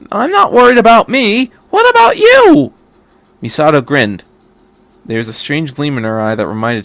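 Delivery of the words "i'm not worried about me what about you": high, louder pleading question rising to a squeak; boy afraid for his commander; worried. Acted. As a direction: plead with her. Shinji fears for Misato.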